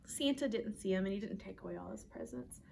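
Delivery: ordinary delivery, nothing marked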